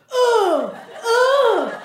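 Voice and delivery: high grunting like a tennis player. Retold or imitated. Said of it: "every guy's like".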